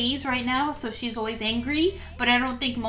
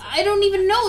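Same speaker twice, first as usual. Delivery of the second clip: louder, higher baffled outburst; 6 semitones higher, 5 dB louder than usual. Real, not acted. Player baffled by the sudden fight, loud and raised. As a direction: throw up your hands in disbelief.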